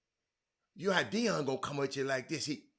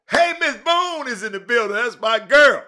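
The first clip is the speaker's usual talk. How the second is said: excited shout-out; 6 semitones higher, 12 dB louder than usual. Real, not acted. Host hails a guest arriving.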